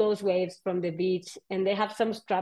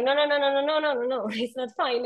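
frantic refusal; 5 semitones higher, faster than usual. Retold or imitated. Refusing guide's reassurance.

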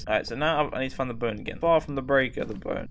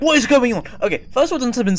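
high, loud startled yell; loud frightened outburst; panicked shout; 9 semitones higher, 6 dB louder, faster than usual. Real, not acted. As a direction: shout it in real alarm. Scared by sudden ghost event.

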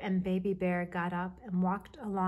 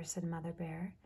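ordinary delivery, nothing marked

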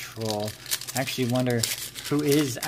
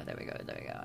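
whispered; 12 dB quieter, much faster than usual. Real, not acted. Soothing card into sleeve.

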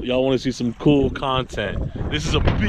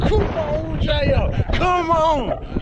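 excited; much slower than usual. Real, not acted. Cheering the fish in.

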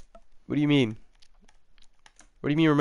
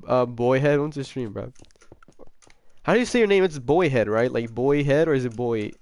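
normal talking voice

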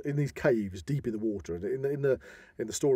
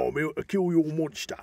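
mock Cockney growl, East End style; East End accent; 5 semitones higher than usual. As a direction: do a Ray Winstone East End hard man, lightly. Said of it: "Ray Winstone is a good old East Ender".

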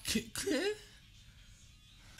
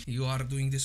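ordinary talk

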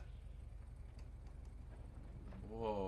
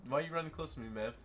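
usual speaking voice

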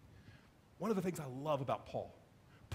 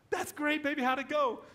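wife's voice; 10 semitones higher, 4 dB louder than usual. Retold or imitated. Wife answering on phone.

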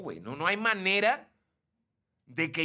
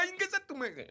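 mock squeal; 14 semitones higher, 4 dB quieter, faster than usual.

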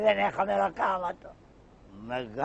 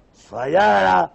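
σφαλιάρα drawn out slow and much louder; loud echo; 10 dB louder, much slower than usual. Acted. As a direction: bellow σφαλιάρα back as a threat. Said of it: "καμιά σφαλιάρα".

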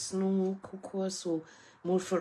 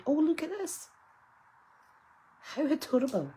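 much higher squeaky cute voice; funny voice; 12 semitones higher, 4 dB louder than usual. Pretend. Squealing over an adorable craft gift, higher funny voice. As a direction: gush like a cartoon. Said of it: "I keep putting on a funny voice".

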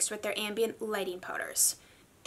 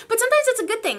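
much higher, louder and fast through a laugh; 12 semitones higher, 9 dB louder, much faster than usual. Real, not acted. Vlogger laughing about being influenced to buy things.

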